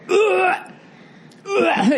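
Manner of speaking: high retching ugh sounds; mock gag like smelling rotten food; imitated gagging. Gordon smelling the rotten fridge food, hammed-up gag. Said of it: "He was going".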